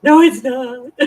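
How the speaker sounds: loud laughing protest. Real, not acted. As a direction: laugh it out, mock denial. Laughing at herself crying.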